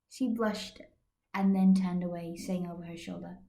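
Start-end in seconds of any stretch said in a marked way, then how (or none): none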